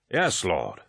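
ordinary delivery

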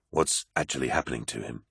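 low, soft, grave question; subdued question. A narrator's reading. Loken asks apothecary about the War Master, subdued. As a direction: ask it low and softly.

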